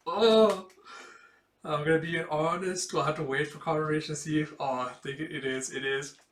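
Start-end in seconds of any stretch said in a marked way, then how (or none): 0.1-0.7 loud, high, sudden exclaim; thrilled gasp of recognition; excited gasp. Real, not acted. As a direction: blurt it out in delight. Recognising the character.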